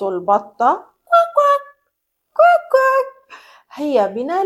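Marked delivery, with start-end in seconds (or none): 1.0-3.4 high, quick quacking like a duck. Shown as a demonstration. Teacher quacking for the children like a duck.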